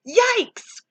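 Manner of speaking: high, sharp yelp of alarm; startled cry. A narrator's reading. Joey Mackerel yelping at the swing. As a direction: jump back with a yelp. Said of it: "cried Joey Mackerel".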